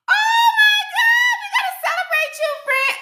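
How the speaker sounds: very high, loud squeal of joy; shrieking congratulations to a new starter; excited shriek. Real, not acted. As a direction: scream with delight for her. Viewer says she started today.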